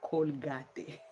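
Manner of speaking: Colgate as col-GAH-teh; Spanish pronunciation. Shown as a demonstration. Recalling learning to say it in Ecuador, Colgate as col-GAH-teh. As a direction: sound every letter of Colgate, Spanish style. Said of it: "Spanish say Colgate".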